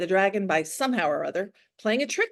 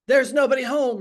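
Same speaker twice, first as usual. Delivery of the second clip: roaring dragon; 4 semitones higher, 6 dB louder than usual. A narrator's reading. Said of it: "roared a voice".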